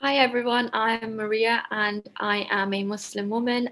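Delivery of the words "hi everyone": usual speaking voice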